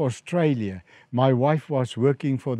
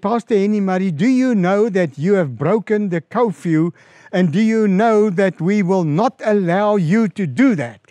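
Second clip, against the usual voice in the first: louder, higher imitation of an indignant official; retold stern reprimand; 6 semitones higher, 5 dB louder than usual. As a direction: lay down the law, mimicking an irate ambassador. Retold angry phone reprimand.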